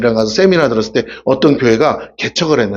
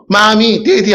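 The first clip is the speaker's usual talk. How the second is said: put-on clumsy child English; 5 semitones higher, much slower than usual. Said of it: "갑자기 서투른 말로".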